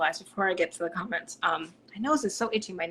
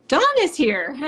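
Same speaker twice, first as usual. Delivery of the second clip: loud, high, delighted call; excited announcement; 8 semitones higher, 11 dB louder than usual. Real, not acted. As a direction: burst out on spotting who you were looking for. Spots the awaited Dawn in comments.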